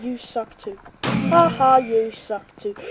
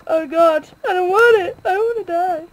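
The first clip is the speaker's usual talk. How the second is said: high, loud frightened pleading; panicky; 6 semitones higher, 6 dB louder than usual. Real, not acted. Kid gamer panicking as someone hunts him. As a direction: beg not to die in fear. Panic when being hunted.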